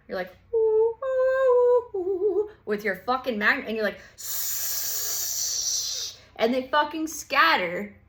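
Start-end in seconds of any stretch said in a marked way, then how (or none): 0.5-2.5 high, loud teasing squeal ooh; gleeful mock squeal. Scorpio with magnifying glass. 4.2-6.1 a whispered shh; hushing shh. Retold or imitated. Scorpio hushing the ants.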